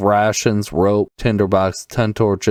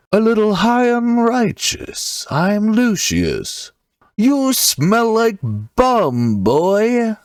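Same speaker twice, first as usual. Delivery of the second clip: high, slow, righteous fighter; Lucius Silverblade; 11 semitones higher, slower than usual. Shown as a demonstration. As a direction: play him high and righteous, drawn out. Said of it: "that's my Lucius voice".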